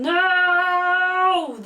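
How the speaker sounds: a high, loud, drawn-out wail of "No!"; Frodo's anguished scream. Retold or imitated. Frodo screaming as Gandalf falls, long anguished cry. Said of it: "diye bağırdığı".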